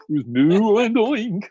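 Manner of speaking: ad slogan. Retold or imitated. Recalling a ridiculous Chicago meat ad in a jingle voice. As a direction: sell it like a cheesy TV ad.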